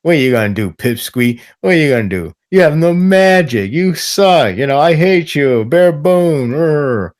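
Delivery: mock villainous taunting; taunting rival. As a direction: sneer like a boastful bully rival. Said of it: "not a rival like".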